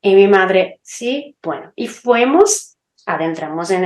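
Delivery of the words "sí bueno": quiet, offhand yes from mother; reluctant mother. Retold or imitated. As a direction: shrug it off quietly. Mother answering the child reluctantly. (un sí materno desganado y bajito)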